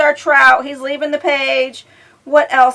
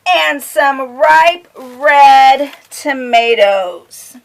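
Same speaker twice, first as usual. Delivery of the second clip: sing-song picture-book reading; reading to children; 3 semitones higher, 6 dB louder, much slower than usual. A narrator's reading. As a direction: lilt it for a little listener.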